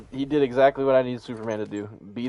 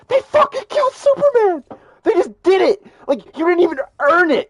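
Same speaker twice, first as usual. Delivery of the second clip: yelled much higher and louder, mock fury; Angry Joe outrage; 8 dB louder than usual. Superfan reacting to Superman's death, shouting in mock outrage. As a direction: imitate a fan screaming betrayal. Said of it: "you should have heard him".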